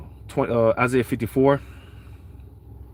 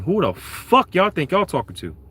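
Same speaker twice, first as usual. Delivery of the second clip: high-pitched challenge on the Lord's behalf; indignant cursing out; 6 semitones higher than usual. Retold or imitated. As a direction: tell them off, voice pitched up. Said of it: "basically what the Lord is saying".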